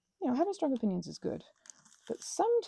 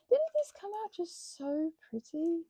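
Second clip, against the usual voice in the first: delighted; 9 semitones higher, slower than usual. Real, not acted. Admiring her finished craft.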